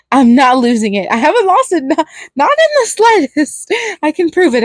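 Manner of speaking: laughing, pitch climbing high and wild; manic laughing clown. A narrator's reading. Clown laughing off his lover's worry, manic and high. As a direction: cackle the denial, voice spiking way up. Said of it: "And then he starts laughing again".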